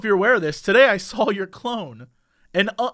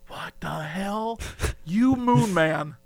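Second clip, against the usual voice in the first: slow, drawn-out amazement; stunned disbelief; much slower than usual. Retold or imitated. Onlooker baffled by the moon man, slow stunned disbelief. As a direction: stretch each word in mock bafflement. Marvelling at the naive customer.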